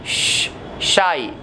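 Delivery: श then शाई, drawn out very slowly; slow sh demo. Shown as a demonstration. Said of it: "थोड़ा रोल कीजिए". (धीरे से श, फिर शाई)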